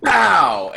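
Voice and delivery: high, loud burst of a pow; loud imitated gunshot. Game master voices the shot players hear, sudden loud pow. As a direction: punch out a gunshot noise. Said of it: "and you hear this".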